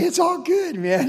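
high, carefree party-bro voice; relieved brothers. Retold or imitated. Brothers at the feast, relieved, in a high breezy voice. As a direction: toss it off like nothing's wrong. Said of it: "So it's like".

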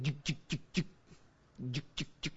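dry clicking of a truck that won't start; imitated engine clicking. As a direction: click out a dead starter, fading off.

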